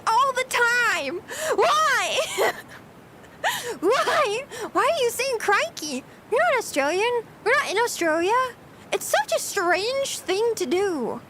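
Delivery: high-pitched, baffled complaint; exasperated disbelief. Real, not acted. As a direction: vent in baffled exasperation, voice jumping up. Exasperated rant about coworker's catchphrase.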